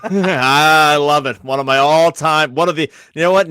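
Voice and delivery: loud, drawn-out delight with a laugh; delighted gushing. Real, not acted. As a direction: gush loudly over a favorite quote. Delighted reaction to co-host's recited quote.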